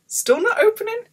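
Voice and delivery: very high, loud squeak of frustration; exasperated squeal. Retold or imitated. Sewer tugging at a stuck drawer, exasperated squeal. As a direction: let the voice shoot up in exasperation.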